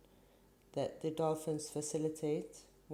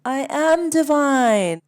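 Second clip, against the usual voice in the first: loud, much higher shout; big exultant slow cry; joyful yell back; 10 semitones higher, 16 dB louder, slower than usual. Acted. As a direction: shout your own divinity back. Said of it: "we all yell back".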